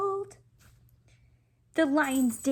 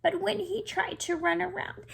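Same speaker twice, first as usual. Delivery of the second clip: usual speaking voice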